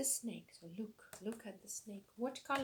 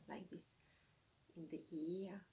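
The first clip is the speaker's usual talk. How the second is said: whispering; 3 semitones lower, 6 dB quieter than usual. Shown as a demonstration. Whispered end of instruction.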